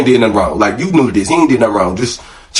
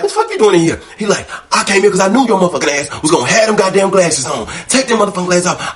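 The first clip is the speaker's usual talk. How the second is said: put-on higher, heated boyfriend rant; hammed-up angry boyfriend, higher; retold scolding; 8 semitones higher than usual. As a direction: voice him chewing you out, pitched up. Angry boyfriend scolding about glasses.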